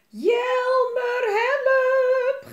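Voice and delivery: very high, loud drawn-out scream; screaming for help. A narrator's reading. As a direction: scream as if carried off into the air. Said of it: "gilde Elise".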